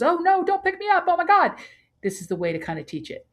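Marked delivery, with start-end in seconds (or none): 0.0-1.5 high, loud squeaky fretting; panicked little dog. Retold or imitated. Trainer voicing a little dog scared of pickup, high and loud. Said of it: "a little dog who goes".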